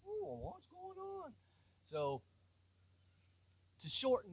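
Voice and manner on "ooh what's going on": puzzled. Retold or imitated. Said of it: "he's scratching his head like".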